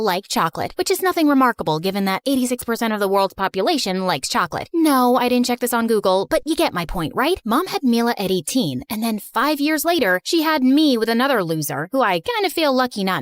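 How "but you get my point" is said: usual speaking voice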